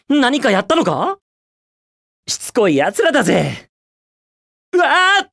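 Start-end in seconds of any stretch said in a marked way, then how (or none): 4.7-5.2 sudden high yelp of alarm; startled yell. Acted. Hero caught off guard mid-run, high alarmed yelp. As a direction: cry out as the ground gives way. Hero falls or gets hit.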